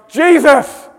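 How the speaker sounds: high, very loud outburst; exasperated shout. Acted. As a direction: shout the name at the end of your tether. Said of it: "and she shouts".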